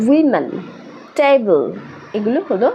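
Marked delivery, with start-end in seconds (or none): 0.1-1.6 "women", "table" said as clear two-beat examples, pitched up; দুই সিলেবলে উচ্চারণ. Shown as a demonstration. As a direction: sound out "women" and "table" in two syllables each, with a pause between the words. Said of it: "দুটো সিলেবলে ভাগ করতে পারি". ("Women", "table" দুই ভাগে স্পষ্ট করে, উঁচু সুরে বলা)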